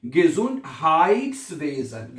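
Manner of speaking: "Gesundheitswesen" slowly, with a long "haaits"; teacher modelling "Gesundheitswesen" as ge-zund-haaits-ve-zen. Shown as a demonstration. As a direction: stretch the ei of "Gesundheitswesen" into a clear "ai". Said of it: "इसलिए इसको हम आई पढ़ेंगे".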